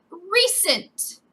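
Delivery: RECENT drawn out, pitched high then falling; emphatic read. Real, not acted. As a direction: lean hard on Recent. Stressing a word read from email.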